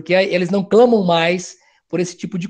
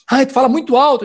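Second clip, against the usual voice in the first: daughter complaining; 4 semitones higher, 5 dB louder, faster than usual. Retold or imitated. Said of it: "daughter repeats complaint".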